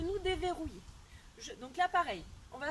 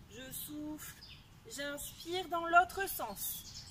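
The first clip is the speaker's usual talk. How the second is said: slow breath cue; much slower than usual. Shown as a demonstration. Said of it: "coach paces the breathing".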